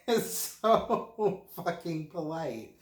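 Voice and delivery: laughing through the words, slow; can't hold the laugh in. Real, not acted. To the viewers about polite locals, laughing. Laughing at his own joke.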